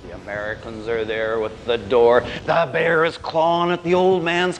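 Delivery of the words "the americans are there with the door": ordinary delivery, nothing marked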